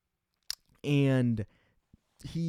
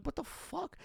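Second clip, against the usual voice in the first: much higher, softer disbelieving squeak; baffled squeak; 9 dB quieter than usual. Retold or imitated. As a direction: squeak it out in outraged confusion. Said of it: "I'm like".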